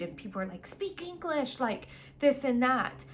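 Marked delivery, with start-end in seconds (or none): 0.7-1.6 mimicked annoyance. Annoyed people to those speaking other languages, mimicked irritation. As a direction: imitate an irritated person, pitch jumped right up. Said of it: "some people get frustrated or annoyed".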